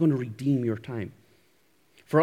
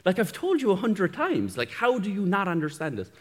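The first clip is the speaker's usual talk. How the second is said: mock the wife yelling in frustration; wife's exasperated yell; 8 semitones higher, 4 dB louder than usual. Wife yelling at him over his academic writing, exasperated shout. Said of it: "she will actually yell at me".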